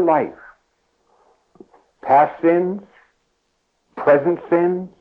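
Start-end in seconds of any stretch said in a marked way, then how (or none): none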